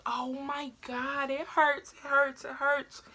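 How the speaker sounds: high, drawn-out mock moan; retold pain. In pain on the car ride, high whining re-enactment. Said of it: "so painful".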